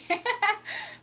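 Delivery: a sudden high laugh; loud laugh. Real, not acted. Streamer laughing at her own airport advice, loud high laugh. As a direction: let a real laugh burst out high. Laughs after TSA tip.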